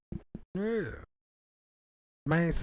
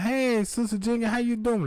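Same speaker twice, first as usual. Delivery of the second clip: bright greeting; 6 semitones higher than usual. Retold or imitated. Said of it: "I'm like".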